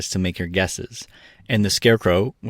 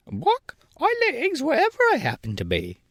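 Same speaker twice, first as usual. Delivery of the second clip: hen voice. A narrator's reading. Billina voiced.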